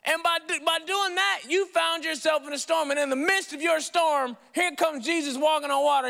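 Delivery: usual speaking voice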